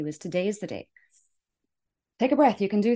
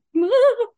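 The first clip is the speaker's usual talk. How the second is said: a short high burst of laughter, louder than her talk; bright laugh; 9 dB louder than usual. Real, not acted. Coach laughing at her own excitement to listeners, a loud laugh.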